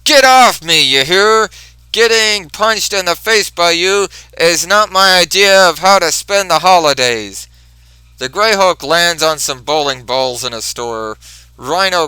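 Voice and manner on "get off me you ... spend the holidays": high, loud protest under attack; loud high angry complaint; angry yell. Retold or imitated. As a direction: shout him off. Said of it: "Rhino says".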